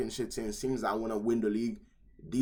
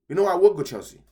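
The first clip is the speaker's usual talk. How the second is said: sudden loud pick; 8 semitones higher, 11 dB louder than usual. Real, not acted. Sudden decision, louder take.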